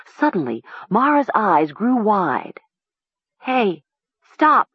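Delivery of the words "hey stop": high, urgent cry; sharp, high call to halt. A narrator's reading. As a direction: cry out in sudden alarm. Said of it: "she cried".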